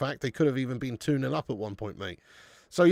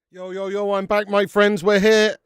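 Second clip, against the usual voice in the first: louder, higher hype-man greeting; hyped return; 4 semitones higher, 7 dB louder than usual. Real, not acted. Streamer welcoming viewers back for the second half, hyped. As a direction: burst back in to rally the viewers.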